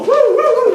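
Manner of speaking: very high, loud whoops; excited whooping. Acted. Brother contrasting himself with non-committal Brian, excited high whooping. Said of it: "like that".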